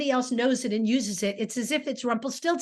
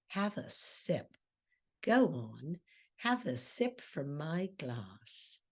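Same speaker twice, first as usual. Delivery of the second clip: slow, much softer and lower; seductive offer; 5 semitones lower, 9 dB quieter, slower than usual. Retold or imitated. Ruth holding the glass out to Lenny, slow soft seduction. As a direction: coax him softly to drink.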